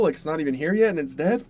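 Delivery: usual speaking voice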